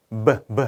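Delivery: "b" as a short bare consonant, twice; isolated consonant sound. Shown as a demonstration. Teacher showing the first sound of book, isolated consonant. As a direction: sound out just the "b", twice over. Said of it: "첫 소리가 자음으로 발음되는".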